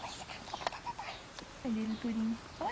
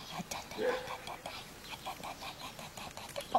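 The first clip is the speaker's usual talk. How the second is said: whispering; 8 dB quieter, faster than usual. Real, not acted. Whispered babble.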